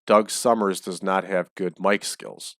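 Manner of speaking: normal talking voice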